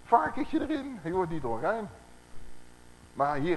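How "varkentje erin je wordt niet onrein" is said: high, smug put-on voice with a laugh; mocking christians. Preacher mocking christians who claim pork is allowed, smug high voice. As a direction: mimic a gloating believer, pitched up. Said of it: "heel wat christenen zeggen".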